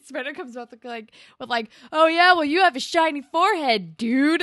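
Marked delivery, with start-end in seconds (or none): 1.9-4.4 loud, high-pitched mock yell; retold yelled comeback. Spinner hurls an insult back at Jimmy, mock yelling. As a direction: fire back loudly, imitating a squabbling kid. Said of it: "they keep yelling at each other".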